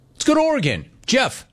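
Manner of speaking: high announcer call-out. Real, not acted. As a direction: bring on the next caller. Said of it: "calling the next caller".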